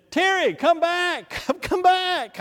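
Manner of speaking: high calling voice, laughing through it; playful high call from a burning bush. Pretend. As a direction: call him back from the bush, half laughing. God calling him from bush.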